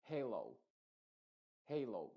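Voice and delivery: "Halo" with the "ei" vowel, not "hello". Shown as a demonstration. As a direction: say "Halo" as "heilou". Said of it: "Esto no es hello".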